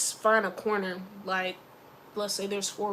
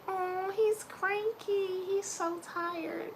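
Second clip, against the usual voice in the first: slow, higher, pitying coo; mocking cooing parent; 8 semitones higher, much slower than usual. Mocking parents excusing a tired child, slow coo. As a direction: drag it out like a doting parent. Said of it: "Be like".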